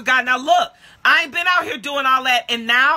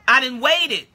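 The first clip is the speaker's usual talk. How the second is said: ordinary talk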